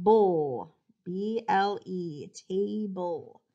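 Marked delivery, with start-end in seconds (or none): 0.0-0.7 "bowl" as an isolated "bull" sound; loud, separate "bowl" syllable with dark l; final syllable alone. Shown as a demonstration. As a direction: stretch "bowl" out, falling. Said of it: "and then we have bowl".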